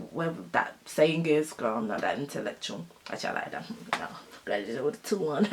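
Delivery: low, hushed aside to oneself; quiet aside. Real, not acted. Vlogger doubting a saying, self-mocking aside under the breath. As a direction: drop right down under your breath.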